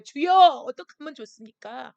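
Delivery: loud pleading cry, leaning hard on 주여; crying out to God. Pretend. Pastor cries out to God over the shut-down land, loud plea. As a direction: cry 주여 out at full voice, begging. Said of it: "부르짖는 거예요".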